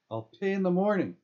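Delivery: high, loud weary retort. A narrator's reading.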